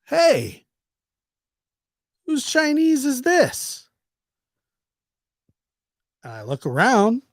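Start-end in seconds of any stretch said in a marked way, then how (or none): 0.1-3.9 high, slow, drawn-out question; nosy coworker. Retold or imitated. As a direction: mimic the guy calling out from upstairs. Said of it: "He goes".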